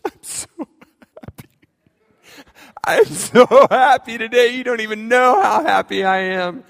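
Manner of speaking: high, loud elated cry, slow at first; hammed-up ecstatic dad; overjoyed. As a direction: gush with relief and joy. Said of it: "I was like".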